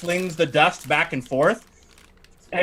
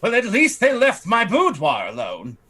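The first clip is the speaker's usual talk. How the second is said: a slightly raised, haughty lady's voice; fussy noblewoman; 7 semitones higher, 3 dB louder than usual. Acted. Lightly raised voice as the lady fretting to the party about her estate. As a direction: play the put-upon aristocrat lamenting her estate. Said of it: "And she goes".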